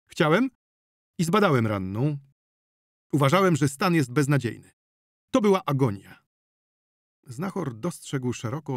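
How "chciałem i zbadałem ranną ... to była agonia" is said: raised, higher doctor's voice; indignant doctor. Acted. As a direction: defend yourself, pressing hard. Doctor defends himself. (oburzony doktor, podniesionym głosem)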